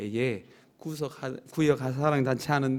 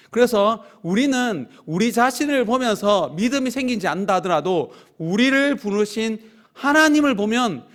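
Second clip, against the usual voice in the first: rising, louder preaching push; 5 semitones higher, 5 dB louder than usual. Acted. Pastor exhorting the congregation, fervent preaching. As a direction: urge the flock forward with conviction.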